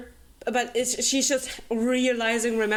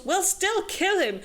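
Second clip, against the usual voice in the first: lightly raised, flippant Isla; mock Isla; 9 semitones higher than usual. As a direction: toss it off higher, like a careless girl. Isla voiced in the retelling.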